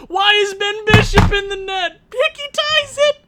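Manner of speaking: high, loud, frantic yelling at the screen; real fan panic, high and loud; excited shouting. Real, not acted. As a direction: scream it as the game slips away. Late goal in a tense game.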